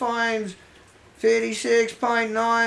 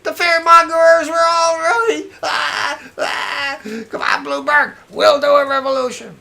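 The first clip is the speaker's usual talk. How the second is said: sarcastic outburst; 4 semitones higher, 7 dB louder than usual. Acted.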